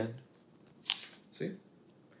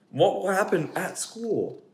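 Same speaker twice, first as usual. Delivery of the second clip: higher, slowed fretting; slow anxious pigeon; 7 semitones higher, 5 dB louder, slower than usual. Acted. Pigeon asks what happens at school, higher and slow. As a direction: wonder nervously.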